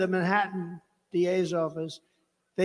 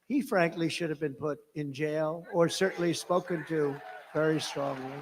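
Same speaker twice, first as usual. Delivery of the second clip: usual speaking voice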